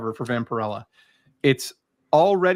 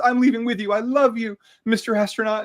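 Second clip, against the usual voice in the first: a higher, mock-swooning woman's voice; lovestruck alien woman; 8 semitones higher, 3 dB louder than usual. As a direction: play the smitten alien girl, lightly hammed up. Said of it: "she says".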